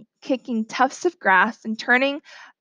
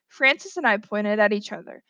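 ordinary delivery, nothing marked

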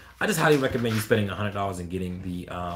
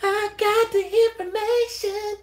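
way higher, sing-song tease; mock teasing; 3 dB louder than usual. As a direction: dangle the promise playfully. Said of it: "I'm just joking with you".

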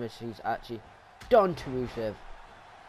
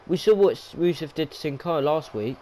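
normal talking voice

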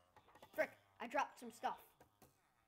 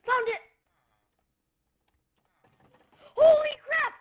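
much higher, much louder yell of discovery; excited shout; 23 dB louder than usual. Real, not acted. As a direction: scream it out in triumph. Finds the hidden village door.

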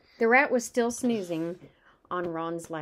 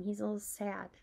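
ordinary delivery, nothing marked

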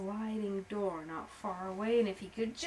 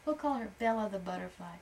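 ordinary delivery

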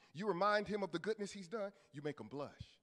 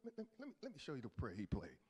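normal talking voice

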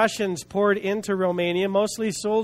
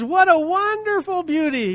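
overjoyed; 9 semitones higher than usual. Retold or imitated. Preacher voicing a Russian officer hearing the gospel, overjoyed. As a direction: exclaim it as if overflowing with joy. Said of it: "so happy and so full of joy".

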